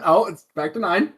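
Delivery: ordinary talk, nothing marked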